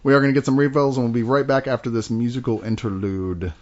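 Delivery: ordinary talk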